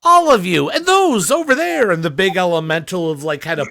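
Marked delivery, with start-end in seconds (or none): 0.0-1.9 loud. Acted. Elemental answers loudly.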